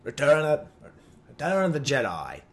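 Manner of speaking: put-on British announcer accent sliding into Australian; mock-British announcer with an Australian slip; botched British accent. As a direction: attempt British, end up Australian. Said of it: "I'm an Australian again".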